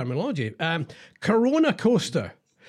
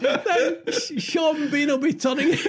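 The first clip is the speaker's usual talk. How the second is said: laughing; 10 semitones higher, much slower than usual. Real, not acted. Laughing at the Yorkshire read.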